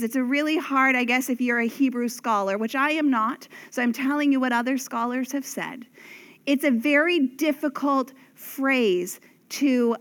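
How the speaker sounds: normal talking voice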